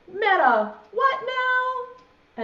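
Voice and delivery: high, loud puzzled question; baffled caterpillar. Acted. Impatient caterpillar asks friend about the big word, baffled. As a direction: balk at the big word, pitch leaping up.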